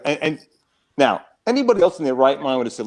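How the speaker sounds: ordinary talk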